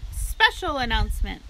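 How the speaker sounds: loud, pompous announcer voice; a fair announcer booming over the loudspeaker. A narrator's reading. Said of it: "in a pompous voice".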